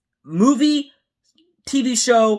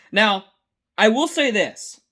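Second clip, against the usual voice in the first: normal talking voice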